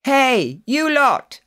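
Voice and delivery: high, carrying call. A narrator's reading. Jack calls up the staircase to the children. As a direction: holler up the stairs. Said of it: "they heard Jack calling up the staircase".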